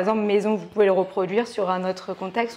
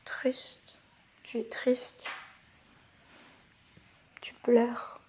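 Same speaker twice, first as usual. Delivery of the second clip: soft demo; 9 dB quieter, much slower than usual. Shown as a demonstration. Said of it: "Donc on a content".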